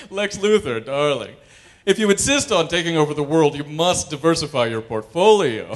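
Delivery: hammed-up posh smarmy voice; smarmy Superman. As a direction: purr at Lex like a rich snob. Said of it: "We'd have smarmy Superman".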